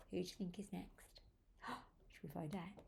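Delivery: quick, very soft aside; low, fast, secretive murmur; hushed suspense. Acted. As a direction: whisper the question to small listeners. Hushed suspense before the next animal.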